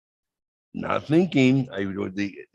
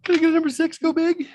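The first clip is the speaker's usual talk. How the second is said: very high, loud mock drive-thru order; hammed-up order; 6 dB louder, faster than usual. Ordering the number six again, very high and loud. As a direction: belt out the order in a silly high voice.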